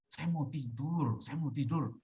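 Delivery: softly retold bedtime plea, slowed at first; quiet plea. Man telling himself he wants to sleep, quiet plea. Pleads with restless self at night.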